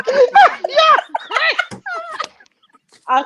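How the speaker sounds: high-pitched, helpless laughing. Real, not acted. Guest cracks up over the forehead joke, laughing. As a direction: talk through a big laugh.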